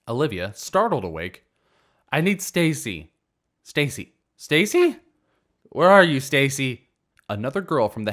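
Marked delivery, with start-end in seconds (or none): 2.1-6.9 put-on loud, high drunken calling. Drunk girl calling for her friend Stacy, loud and high. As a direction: call for her friend across the room.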